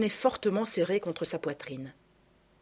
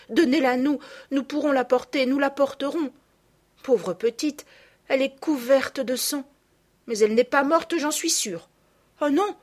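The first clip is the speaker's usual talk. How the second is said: put-on higher, louder, pressing little girl; urgent child pleading; 6 semitones higher, 4 dB louder than usual. A narrator's reading. Camille begs the nurse to hand over the child, urgent and high. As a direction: beg to carry the hurt child, rising to a cry. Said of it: "dit Camille".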